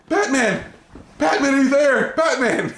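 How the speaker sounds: high-pitched mock distressed calling; Robin. Robin looking for Batman by the pile of metal, distressed cries. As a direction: call out in hammed-up distress. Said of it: "he's very distressed".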